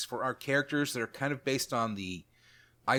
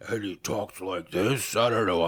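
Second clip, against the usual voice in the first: deep growly bugbear; 5 semitones lower than usual. Shown as a demonstration. Showing his new bugbear character voice, deep growl. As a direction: growl it low like a big beast. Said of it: "but it's really deep and growly".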